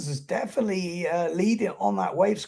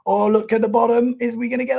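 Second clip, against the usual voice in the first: ordinary talk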